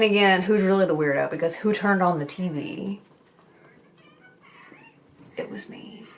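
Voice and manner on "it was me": barely voiced, near whisper; hushed confession. Real, not acted. Mother admitting she turned on the TV, whispered confession. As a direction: drop to a tiny whispered admission. Sheepish confession after a pause.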